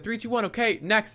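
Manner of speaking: high-pitched countdown call; relentless line handler. Retold or imitated. Woman calling the next photo, relentless and high. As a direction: imitate someone moving the line along. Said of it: "she was being, like, relentless with it".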